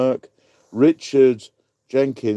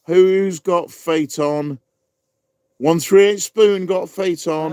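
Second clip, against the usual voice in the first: excited; 8 semitones higher, 5 dB louder than usual. Real, not acted. Quiz host calls the winner.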